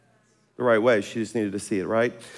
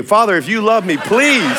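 higher, louder hammed-up pleading to God; mock desperate prayer; 8 semitones higher, 5 dB louder than usual. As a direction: beg heaven half joking. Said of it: "my prayer life's improving".

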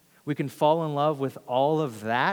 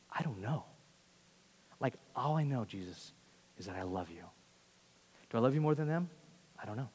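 soft, halting, humbled admission; 4 semitones lower, 9 dB quieter, faster than usual. Acted. Humbled Peter answers Jesus after having failed, soft and hesitant. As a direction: confess it quietly, with pauses, dropping low at the end. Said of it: "in all humility, having failed".

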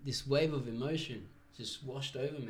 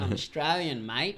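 loud, exaggerated Aussie accent; louder, hammed-up Australian twang; put-on Australian accent; 3 semitones higher, 7 dB louder than usual. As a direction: ham up a broad Australian accent. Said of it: "I put on this awkward accent".

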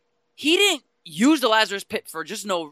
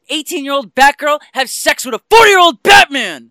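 loud indignant outburst; outraged shout; 2 semitones higher, 12 dB louder than usual. Real, not acted. Outraged rant about the film.

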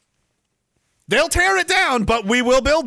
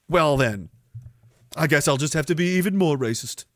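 ordinary talk

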